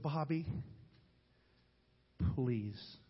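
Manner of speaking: a grandmother begging forgiveness through tears, softly; imitating tearful plea. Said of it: "She followed me out with tears in her eyes".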